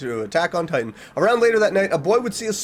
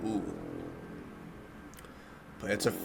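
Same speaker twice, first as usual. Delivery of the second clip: usual speaking voice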